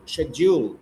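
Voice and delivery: "Schedule" said shed-JOOL with a clear J; "Schedule" with sh at the start and j in the middle; corrected British pronunciation. Shown as a demonstration. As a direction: model "Schedule" as shed-jool, pitch falling.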